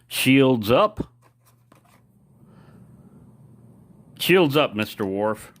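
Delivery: loud commanding order, captain on the bridge; playful captain calling to his officer, loud command; mock starship captain. Playful Star Trek captain order.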